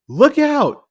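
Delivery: imitating a throwaway "Look out" with no urgency; [name]'s offhand warning. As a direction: toss off the warning like it's nothing. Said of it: "But he says it just like that".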